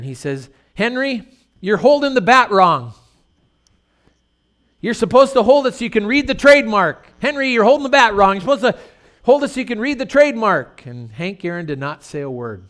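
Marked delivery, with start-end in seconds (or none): 0.7-10.9 high, loud ballpark needling; loud heckling chatter. Retold or imitated. Catcher heckling the batter at the plate, loud and high. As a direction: razz the batter from behind the plate.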